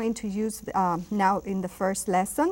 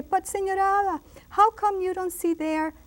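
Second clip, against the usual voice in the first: much higher puzzled student voice; questioning pupil; 10 semitones higher than usual. Acted. Pupil asking Señora Ada about a missing pronoun, puzzled and high-pitched. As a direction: pipe up like a pupil querying the teacher. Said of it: "And you'll say".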